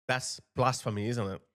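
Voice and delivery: normal talking voice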